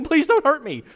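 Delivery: high, pleading mock plea of a frightened demon; hammed-up demon begging Jesus not to hurt him. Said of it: "starts begging".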